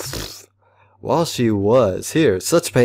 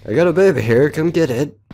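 louder, higher call; 5 semitones higher, 4 dB louder than usual. Real, not acted. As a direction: call out to the room. Calling out in game.

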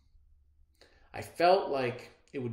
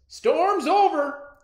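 high, slow, loud call; crying out; 12 dB louder, much slower than usual. Acted. Father calling to the family in the cellar, loud cry. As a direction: holler the all-clear, stretching it out. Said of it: "he cried".

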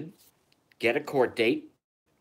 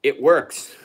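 loud; 2 semitones higher, 8 dB louder than usual. Real, not acted. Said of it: "laughing boast".

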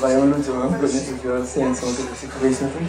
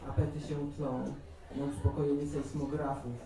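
softer, phrase-by-phrase poem reading; poetry recitation; 10 dB quieter than usual. Acted. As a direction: recite verse in measured lines, growing quieter. Reads second poem aloud.

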